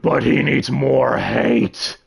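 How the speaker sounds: slow, menacing villain thought; scheming villain. Acted. Claude thinks to himself about Alice's soul, sinister. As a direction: draw it out like a plotting demon.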